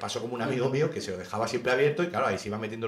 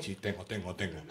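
hushed mime reply; 6 semitones lower, 11 dB quieter than usual. Acted. Mime exchange.

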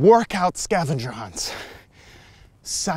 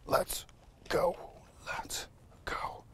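whisper; 3 semitones higher, 12 dB quieter, slower than usual. Real, not acted. Urging quietly uphill.

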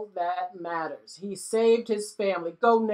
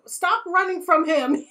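louder, giggly telling-off; laughing plea; 9 semitones higher, 6 dB louder than usual. Real, not acted. Telling the butler off with a laugh. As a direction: laugh as you scold.

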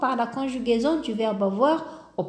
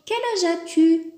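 higher, louder question; asking a friend; 6 semitones higher, 3 dB louder than usual. Shown as a demonstration. Teacher acting out asking a friend their age. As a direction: ask it like you mean it. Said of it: "On pose la question".

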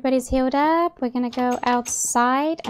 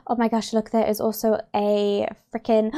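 ordinary talk, nothing marked

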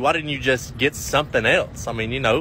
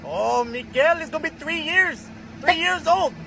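much higher voice for Pablo; 13 semitones higher, slower than usual. Acted. Pablo answering Miguel about his nephew in a much higher voice.

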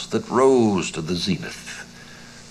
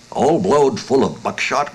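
gruff growl, raised and louder; 6 semitones higher, 5 dB louder than usual. Acted. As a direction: growl it back in suspicion. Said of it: "growled Durant".

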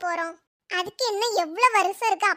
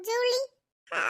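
normal talking voice

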